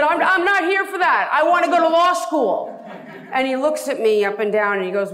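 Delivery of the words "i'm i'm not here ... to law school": much higher and louder, rushing to explain; desperate to be taken seriously; eager blurt. Retold or imitated. Telling the lawyer he only wants law school, eager and high.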